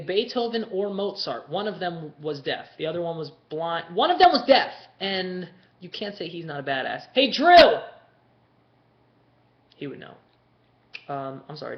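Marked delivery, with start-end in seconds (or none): 3.9-4.7 high, loud insistence; emphatic outburst. Real, not acted. Emphatic repeat of own claim. 7.1-7.8 high, loud holler; calling out. Real, not acted. Calling to someone off mic.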